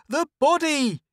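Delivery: high, loud scream. A narrator's reading. Lois screaming at the crowd holding him, high and loud. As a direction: scream it in panic. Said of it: "Lois screamed".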